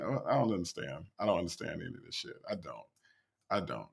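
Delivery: very low, soft, quick mumble; defeated muttering. Real, not acted. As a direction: trail into a baffled mutter. Gives up understanding the clip.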